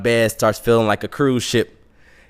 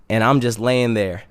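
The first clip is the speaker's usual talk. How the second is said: ordinary delivery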